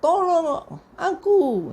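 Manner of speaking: high, drawn-out imitated call for help; crying out. Blind beggar calling to Jesus for help, imitated crying out. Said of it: "langsung dia berteriak".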